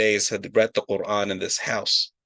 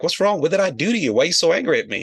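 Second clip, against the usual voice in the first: pleading confusion; 7 semitones higher, 4 dB louder, faster than usual. Retold or imitated. Imam pleads with cold host.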